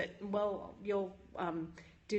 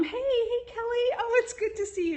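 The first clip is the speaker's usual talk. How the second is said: high, loud, happy hello to a friend; delighted greeting; 14 semitones higher, 6 dB louder than usual. Real, not acted. Host spots a friend joining her livestream, delighted greeting.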